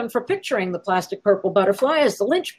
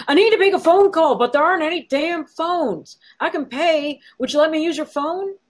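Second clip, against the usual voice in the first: pleading, voice shaking; 6 semitones higher than usual. A narrator's reading. Young man begs the bartender for a phone, high and pleading. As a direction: beg for the phone, close to breaking. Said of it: "His eyes pleading, voice shaking".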